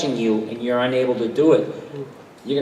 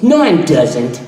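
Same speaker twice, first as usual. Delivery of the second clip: louder, dim-witted "No, I'm doesn't."; Fry's dopey line; 4 semitones higher, 8 dB louder, slower than usual. Retold or imitated. Fry answering the beer-makes-you-stupid jab, dopey and louder. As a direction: play it as if he can't hear the mistake. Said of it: "he goes".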